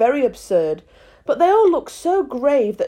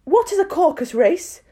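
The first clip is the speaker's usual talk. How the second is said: put-on young girl voice; 8 semitones higher than usual. Alice speaks in the story.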